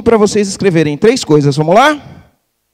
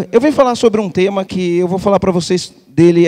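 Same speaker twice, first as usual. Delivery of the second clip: ordinary talk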